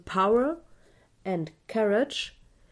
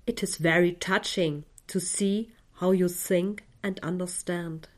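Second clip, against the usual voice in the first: whispering; 3 semitones lower than usual. Acted. Whispered affirmation in meditation.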